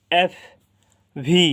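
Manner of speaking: এফ and ভি with audible outgoing breath; air released from mouth. Shown as a demonstration. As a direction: push air out on এফ and ভি. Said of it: "মুখের ভেতর থেকে বাতাস বের হয়ে যাবে".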